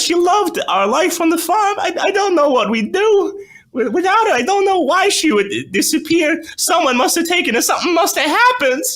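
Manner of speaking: very high, loud pleading mother; desperate mother. Acted. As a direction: beg the psychic, near tears. Mother's long tearful plea.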